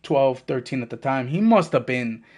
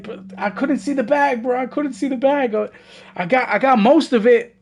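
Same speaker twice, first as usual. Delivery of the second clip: pleading excuse; 7 semitones higher than usual. Retold or imitated. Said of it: "I was like".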